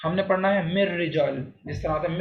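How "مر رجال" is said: مر رجال with a doubled rolled r, no n; noon dropped. Shown as a demonstration. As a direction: merge مر رجال on the r. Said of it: "نون کی آواز نکالنی ہی نہیں ہے". (مر رجال میں نون کے بغیر را ملا کر پڑھیں)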